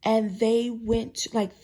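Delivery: normal talking voice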